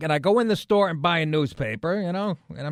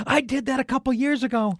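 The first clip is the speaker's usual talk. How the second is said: higher put-on woman's voice; mock woman's voice; 9 semitones higher than usual. Woman at gas pump consoling him, imitated in high voice. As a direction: imitate her, pitched well up.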